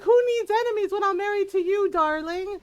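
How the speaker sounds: high, loud, fast sarcastic jab. Retold or imitated. Gamer imitating husband's sarcastic jab at her, fast and high. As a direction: tease with mock sweetness on darling. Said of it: "He's like".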